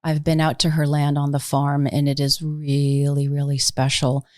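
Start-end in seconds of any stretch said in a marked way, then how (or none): none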